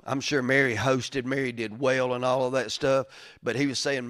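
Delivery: ordinary delivery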